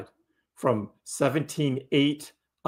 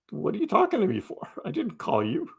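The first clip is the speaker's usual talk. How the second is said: higher-pitched mock indignation; indignant retort; 6 semitones higher, faster than usual. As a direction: act out baffled protest at an uninvited interruption. Acts out reply to Siri.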